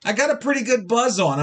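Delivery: normal talking voice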